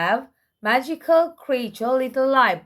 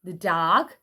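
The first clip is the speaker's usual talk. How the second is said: ordinary delivery, nothing marked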